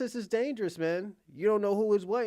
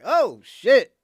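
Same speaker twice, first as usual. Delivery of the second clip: loud burst of surprise, pitched up; shocked oh shit; 4 semitones higher, 13 dB louder than usual. Retold or imitated. Man reacting to her dirty look, loud startled outburst. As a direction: blurt it out, caught off guard. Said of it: "You be like".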